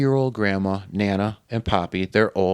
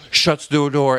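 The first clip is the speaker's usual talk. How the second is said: usual speaking voice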